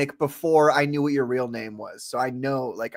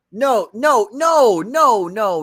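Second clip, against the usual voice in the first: loud protest; 6 semitones higher, 7 dB louder, much slower than usual. Real, not acted. Frantic denial.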